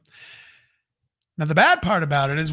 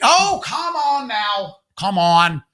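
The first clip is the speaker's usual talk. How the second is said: mock insistent host; pushy stranger voice; 8 semitones higher than usual. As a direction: wheedle them into the van. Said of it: "tell his voice in a way of how he made me feel".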